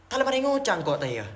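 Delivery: "taye" run quickly into the phrase; quick Madurese "taye". Shown as a demonstration. Showing how Madurese say it, quick "taye". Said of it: "dicepetin tuh ada".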